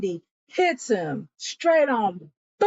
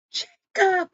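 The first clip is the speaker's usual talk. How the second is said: higher, ringing call of his name; mock startled call; 9 semitones higher than usual. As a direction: call out to him across the road. Woman calls out to Jacob.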